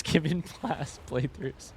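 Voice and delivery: slow words broken by laughter; real laugh running through the punchline. Real, not acted. Joking about his playthrough schedule.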